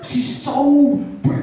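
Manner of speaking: high, slow, dreamy fan voice, cut off; swoon, then stop short; mock gushing fan. Mocking the fan who calls her brave.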